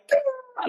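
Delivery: a high, breaking wail as it all lets go; anguished cry. Retold or imitated. Boy finally breaking down in his grandmother's arms, high wail. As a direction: burst out in a sob-cry of relief. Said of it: "I'm like".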